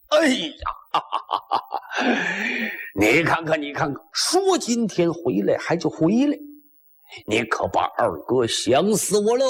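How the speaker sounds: high hearty shout, laughing between words; 贺天宝 calling out gladly. Acted. The second brother calling and laughing as he welcomes Huang Tianba back. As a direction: hail a long-missed brother from afar, laughing. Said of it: "离老远的就喊".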